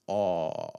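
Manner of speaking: long, low open "aw". Shown as a demonstration. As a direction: hold an open "aw" vowel. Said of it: "this more open sound".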